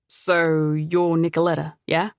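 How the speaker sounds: put-on refined foreign accent, not quite British. A narrator's reading. Stranger at the door introducing himself, refined foreign accent. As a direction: introduce yourself with a vaguely European accent. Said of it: "He had an accent".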